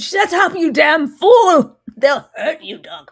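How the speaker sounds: high, loud mock shout with a laugh; hammed-up yell. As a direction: bellow the warning in jest. Re-enacting Dunk's yell.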